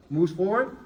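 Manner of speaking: usual speaking voice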